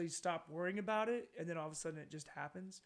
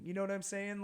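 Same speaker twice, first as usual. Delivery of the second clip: normal talking voice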